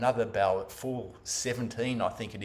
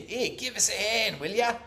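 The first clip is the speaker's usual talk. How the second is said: higher-pitched gruff call from an old man, lightly voiced; old guy yelling; 10 semitones higher, 3 dB louder than usual. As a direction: call out to a stranger for help, mildly mock-yelled. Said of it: "he just yelled at me".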